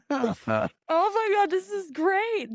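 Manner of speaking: slow, high, laughing drawl; real amused disbelief, slow and high. Real, not acted. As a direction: stretch it out through laughter. Laughing disbelief at crumpet talk.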